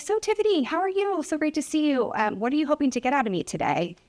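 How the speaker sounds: high, cheerful welcome to a patient; overly upbeat clinic greeting; bright doctor greeting. Retold or imitated.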